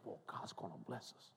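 awed whisper. Retold or imitated.

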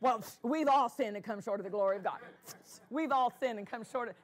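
fast, rote recital of a memorized verse; mock the glib, practiced quoting, fast; rattled-off stock quote. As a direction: fire it off like a quick draw. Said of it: "they're practiced on pulling that one out".

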